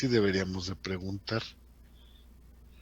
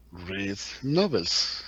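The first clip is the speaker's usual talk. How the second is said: slow English question; much slower than usual. Shown as a demonstration. Teacher models the English question slowly. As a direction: read novels? spoken slowly, novels stretched. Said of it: "¿cómo lo haría en inglés?".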